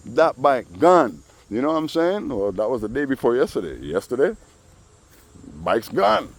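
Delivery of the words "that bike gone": drawn out slowly with a falling end. Shown as a demonstration. Telling about the neighbor's bike, slow and savoring. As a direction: stretch "That bike gone" slow and final.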